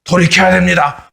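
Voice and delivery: serious, pleading preacher call; earnest, pleading prophet's call. Shown as a demonstration. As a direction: urge them earnestly to repent. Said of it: "이렇게 진지하게 이야기하는데".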